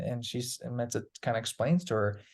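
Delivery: normal talking voice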